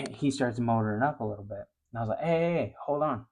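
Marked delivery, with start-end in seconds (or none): 2.2-3.3 higher-pitched "hold on" objection; retold call to make him stop; retold protest. Angler protests as his buddy motors the boat up, retold protest.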